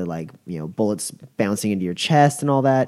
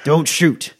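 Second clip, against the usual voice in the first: louder, higher sharp command; commanding order; 8 semitones higher, 7 dB louder, slower than usual. Acted. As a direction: stop the guard dead. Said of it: "and says".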